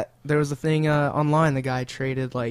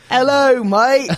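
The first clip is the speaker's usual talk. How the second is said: high, loud mock English greeting; hammed-up English accent, loud and high; thick English accent; 11 semitones higher, 7 dB louder, much slower than usual. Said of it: "fucking thick English accent".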